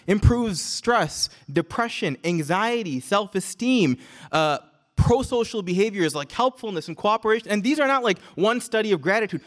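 ordinary talk, nothing marked

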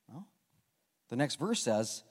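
normal talking voice

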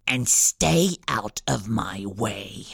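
villain's last warning before vanishing, deep and slow; deep slow threat. A narrator's reading. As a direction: drop the voice deep and slow it right down. Wilfred final threat.

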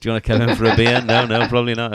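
jolly, chuckling offer to the police; laughing invitation. Retold or imitated. Fan laughing as he invites police in, loud. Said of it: "I was just like".

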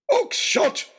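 bellowed name, high and loud. Acted. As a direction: roar his name across the square. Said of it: "bellowed Lord Daunt".

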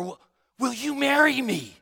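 heartfelt, keen marriage question; eager proposal. Shown as a demonstration. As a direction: propose like you mean it. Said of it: "your tone of voice makes a difference".

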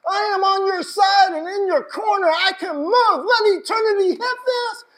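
loud, high impassioned preaching; shouting sermon climax; full-force preaching. Real, not acted. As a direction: preach it with everything, rising up.

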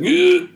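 high, loud grunt of heavy effort; strained lifting grunt. Retold or imitated. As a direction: heave it up with a big grunt. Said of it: "he's like".

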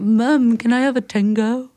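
put-on high-pitched little British kid; British child. Child asking Mom for a treat, British accent. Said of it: "kind of went British".

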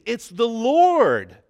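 loud, high, slow cry of recognition; excited scream. Retold or imitated. John in the boat recognizing Jesus, loud excited scream. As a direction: scream it out, stunned with joy. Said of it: "John screamed".

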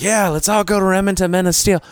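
rallying the group across the street; eager rallying call. Retold or imitated. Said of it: "So I was like".

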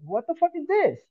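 high-pitched retold shock; stunned disbelief. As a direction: react as if you can't believe your eyes. Sees crowd painting same wall.

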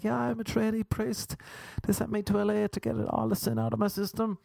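put-on high-pitched pious young priest act; mock trainee priest. Acted trainee priest explaining himself.

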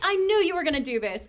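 high, playfully exasperated protest; mock outrage. Co-host accusing her partner of taking her topic, playfully outraged. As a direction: protest as if your topic was stolen.

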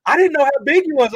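high, loud amazement; high loud wonder; amazed quote. Retold or imitated. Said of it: "she was like".

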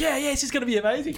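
high, quick fake enthusiasm; mock-eager crew. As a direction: rush it out like an overkeen crew. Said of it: "and they're all like".

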